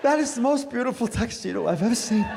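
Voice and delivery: put-on old lady admiring the tux, high and gushing. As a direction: gush like she's near tears.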